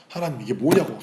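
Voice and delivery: loud, accusing cry to God; retold yelling. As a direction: demand an answer, re-enacting a shout. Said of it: "소리 지르면서 따지러 갔어요". (소리치며 따지듯 재연)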